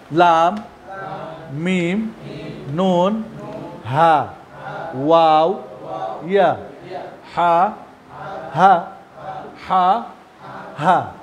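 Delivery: প্রতিটি অক্ষর জোরে আলাদা করে; letter demo. Shown as a demonstration. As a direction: call out each letter clearly. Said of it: "কই সমস্যা কোথায়?".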